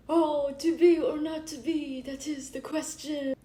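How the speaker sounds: loud, hammed-up theatrical lament; mock heartbroken Hamlet. Heartbroken drama teacher quoting Hamlet over a ladybug, hammed-up lament. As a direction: declaim it high and loud like a melodramatic actor. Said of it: "so heartbroken and started quoting Hamlet".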